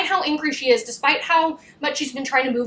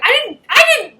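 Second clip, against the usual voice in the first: high, loud laughing protest; hammed-up incredulous squawk; mock exasperation; 13 semitones higher, 8 dB louder than usual. Him correcting her evasive answer, mock exasperated.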